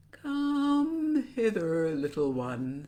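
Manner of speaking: coaxing crocodile. A narrator's reading. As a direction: stretch "come" right out, luring. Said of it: "said the crocodile".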